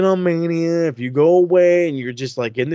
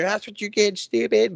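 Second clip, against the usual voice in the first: taunting sister voice; 7 semitones higher than usual. Retold or imitated. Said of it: "sister's gonna cheer".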